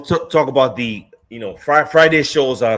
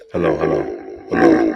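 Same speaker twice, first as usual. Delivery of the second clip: toy echo microphone. Real, not acted. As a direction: toy mic pitched way down. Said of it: "it makes my voice deep".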